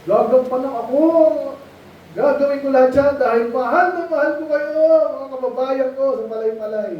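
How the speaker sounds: high, sugary campaign-promise voice; a preacher mocking a politician's sweet promises to the crowd. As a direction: ham it up like a smooth-talking candidate. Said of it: "mga politiko".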